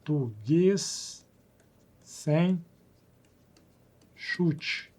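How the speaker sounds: "Português Sem Chute" very slow and soft, word by word with pauses; spelled out slowly. Shown as a demonstration. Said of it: "assim, ó".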